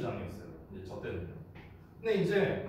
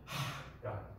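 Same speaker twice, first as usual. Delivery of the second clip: exasperated; 7 semitones lower, 6 dB quieter than usual. Retold or imitated. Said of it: "어이가 없는 거야".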